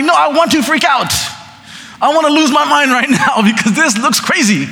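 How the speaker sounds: mock freak-out, laughing at himself. Preacher voicing his own panic at being told to calm down. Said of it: "'cause I'm like".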